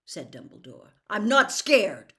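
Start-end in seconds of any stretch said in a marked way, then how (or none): 1.1-2.1 firm, louder denial, stress on not; quick denial. Acted. Harry insisting to Dumbledore he isn't afraid, firm denial. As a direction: snap back that you're not scared. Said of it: "said Harry at once".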